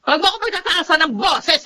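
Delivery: angry shout. Acted. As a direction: cut her off with an angry yell. Mother snaps at daughter-in-law.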